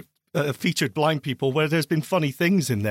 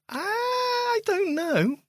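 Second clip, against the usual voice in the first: hammed-up miserable old man whine; much slower than usual. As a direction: whimper it out like a grumpy old man. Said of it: "talking as the miserable old man".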